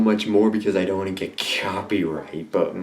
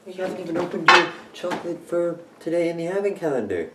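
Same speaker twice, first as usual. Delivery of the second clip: scolding voice; 10 semitones higher than usual. Pretend. Skit voice scolding the reviewer.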